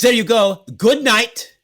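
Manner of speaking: high, loud curt dismissal; mock curt clerk. Clerk dismissing the guest with the key, a loud curt send-off.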